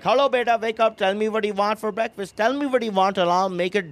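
doting, insistent mock-mom patter; mom waking son. Mom offers hungover son breakfast, mock motherly. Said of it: "she's waking me up".

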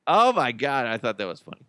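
usual speaking voice

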